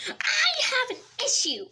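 high, loud comic voice; silly character voice. Acted. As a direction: play a goofy patient. New patient arrives.

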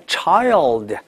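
put-on Child drawn out with a full dark L before d; slow Child, tongue up for the L. Shown as a demonstration. Teacher shows how 儿童 is said, slow dark L. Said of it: "你这个L一定要学好".

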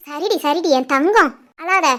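put-on low, slow and soft, calming a crying child; soothing. Father soothing crying daughter. (அழும் மகளை தாழ்ந்த மெதுவான குரலில் சமாதானப்படுத்துதல்)

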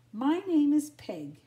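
loud, high and brassy; a bold friendly dame; Peg voice. Acted. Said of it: "she said to Lady".

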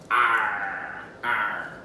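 low, long pirate "Arr"; playful mock pirate cry; pirate growl. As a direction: drawl it like a pirate.

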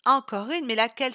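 startled outburst; surprised exclamation. Acted. Isa reacting to Sylvie's new idea, surprised shout. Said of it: "s'écria Isa avec surprise".

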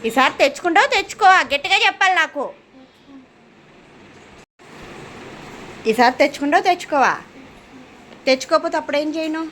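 high, loud parental pressing; stern demand. Real, not acted. Mother demands promise of better marks.

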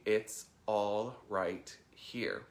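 very slow, word by word; slow mantra, falling at the end. Shown as a demonstration. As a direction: let each word land with space after it. Slowly models the mantra.

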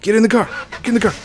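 high, loud urgent yelling; urgent shouting. Real, not acted. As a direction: yell orders in a panic. Panicked game commands.

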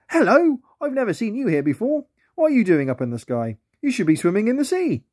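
put-on high, curious young otter voice; a friendly otter puzzled by a flying plane; playful sea otter. A narrator's reading. Otter greeting a plane in the sky, high and curious.

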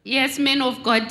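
ordinary talk

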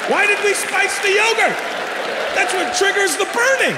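very high-pitched exasperated wail; hammed-up childish outrage; mock anguish. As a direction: protest in squealing disbelief. Child wails about spiced yogurt.

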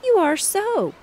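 put-on a boy insisting he's right; insistent assertion. A narrator's reading. Boy contradicts his sister, loud and insistent. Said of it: "asserted Bunny".

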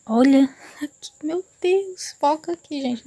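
high, thrilled cries; excited squeal. Real, not acted. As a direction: squeal with delight at the camera. Excited over the viewfinder.

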